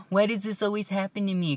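mock a sulky victim; whiny self-pity. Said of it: "you're not always like".

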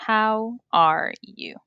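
"How are you?" slowly, word by word; slow teaching model. Shown as a demonstration. Word-by-word recap of model phrase. ("How are you?" lento, palabra por palabra)

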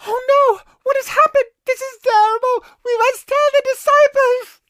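very high, loud panicked cry; alarmed. Acted. Women find the empty tomb.

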